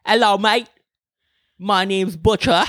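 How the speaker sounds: playing a rough Aussie bloke in Australian accent. Host shows off his Australian accent to his cohost, hammed-up. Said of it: "I also do a really good Australian".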